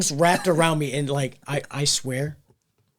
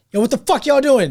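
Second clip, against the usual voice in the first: loud, high challenge yelled at the guys; angry yell; 9 semitones higher, 7 dB louder than usual. Retold or imitated. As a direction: call them out loud and high. Girlfriend confronting frat boys.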